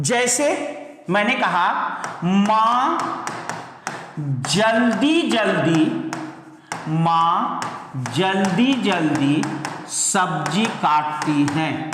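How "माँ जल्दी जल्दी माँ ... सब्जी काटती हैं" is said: very drawn-out, word by word; stretched syllables, as if writing along; slow dictation. Shown as a demonstration. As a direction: dictate it slowly with pauses between pieces. Teacher dictates example sentence slowly.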